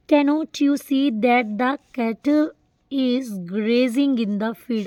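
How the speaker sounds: each word read out slowly; very slow, deliberate classroom reading; slow dictation. Shown as a demonstration. Teacher reads example sentence aloud.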